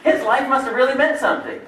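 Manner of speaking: mock the funeral crowd's verdict; a hint of a sarcastic mourner; mock eulogy. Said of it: "mock funeral eulogy".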